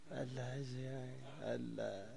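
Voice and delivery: very soft, low murmur of awe at the wine; awed murmur. Acted. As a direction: breathe it out, half to yourself, enchanted. Konstantin marveling at wine alone.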